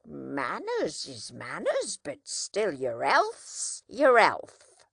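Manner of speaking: earnest countryman. A narrator's reading. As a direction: press the point with anxious care. Joe's anxious rustic advice.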